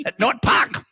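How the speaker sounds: put-on "At Nort Pahk" laughing in a Hungarian accent; lightly hammed-up Hungarian accent; mock Hungarian accent. Mocking the bird lady's Hungarian accent, laughing. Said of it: "I can't do a Hungarian accent".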